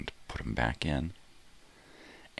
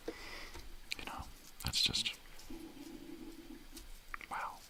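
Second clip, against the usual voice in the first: hushed whispered aside; whispering; 11 semitones higher, 12 dB quieter, much slower than usual. Real, not acted. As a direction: whisper it close to the mic.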